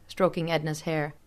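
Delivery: plain reading voice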